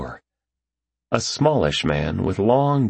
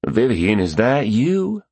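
higher, slow, tentative Southern drawl; 5 semitones higher, slower than usual. A narrator's reading. Stranger tentatively recognizes Vivian, Southern drawl. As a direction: drawl it upward in a Southern accent. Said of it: "the man said in a strong southern drawl".

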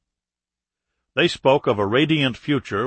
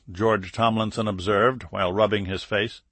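ordinary delivery

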